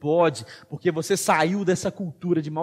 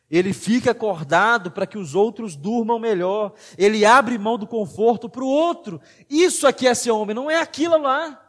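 louder, impassioned sermon delivery; fiery preaching; 5 semitones higher, 5 dB louder than usual. Acted. Pastor urging the men of the church, preaching at full force. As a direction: drive every line home to the congregation.

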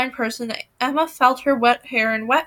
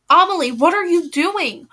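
higher, raised cry of alarm; screamed; 5 semitones higher than usual. A narrator's reading. Narrator screams at her sleepwalking sister in the waves, mild mock scream. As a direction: cry out in panic. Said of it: "I screamed".

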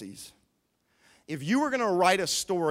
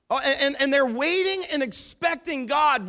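preaching at full force; 5 semitones higher than usual. Real, not acted. Preaching climax.

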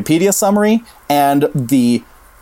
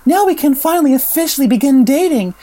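Brooke; 11 semitones higher than usual. Retold or imitated. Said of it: "it's Brooke telling Jeremy that".

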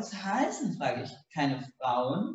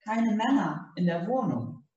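innocent; 6 semitones higher than usual. A narrator's reading. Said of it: "meint Donna unschuldig".

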